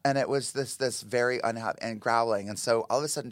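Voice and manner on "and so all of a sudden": ordinary talk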